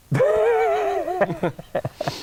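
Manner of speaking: a real laugh jumping high; high, helpless laugh. Real, not acted. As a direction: crack up at the statistic. Laughing at the Easter statistic.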